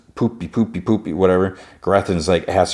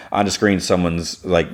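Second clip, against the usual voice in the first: ordinary delivery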